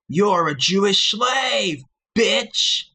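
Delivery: high, slow, sneering mock villain; maniacal villain voice. Vlogger mocking critics in a maniacal villain voice. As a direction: cackle down at the commenters from far above. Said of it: "when I laugh at anybody's comments maniacally, like this".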